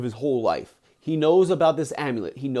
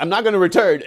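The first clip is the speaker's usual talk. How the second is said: loud mock-stubborn outburst; defiant refusal; 3 semitones higher, 7 dB louder than usual. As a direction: burst out as the defiant disciple. Disciple defying guru, acted.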